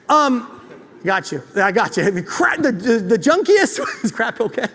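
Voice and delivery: usual speaking voice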